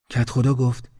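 ordinary delivery, nothing marked

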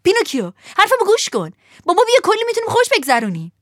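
high, loud, fast excited yell; shouting. A narrator's reading. Lampwick shouting to Pinocchio to come along. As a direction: call out to win him over. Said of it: "فیتیله فریاد زد".

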